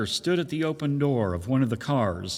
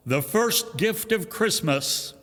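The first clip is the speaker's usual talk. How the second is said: Santa calling out; 7 semitones higher, slower than usual. Acted. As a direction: hold the bell up and announce it to all. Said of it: "and called out".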